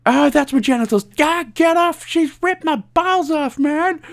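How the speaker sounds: comic high-pitched yelp of pain; panicked pain squeal. Acted. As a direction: shriek like he's being mauled. Voices the victim being mauled.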